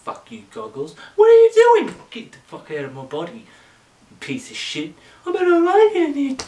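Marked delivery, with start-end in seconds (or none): 1.2-2.0 much higher, loud put-on character voice; Goggles voice. Goggles introduces himself to the audience, high and loud. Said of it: "I'm Goggles". 5.2-6.4 much higher, louder and fast character voice; Goggles voice. Acted. Goggles mocks the host from inside his body, high, loud and fast. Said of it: "I'm Goggles".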